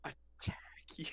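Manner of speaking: whispered aside; soft unvoiced whisper for effect. Real, not acted. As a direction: breathe it as a hushed whisper. Teasing about a friend.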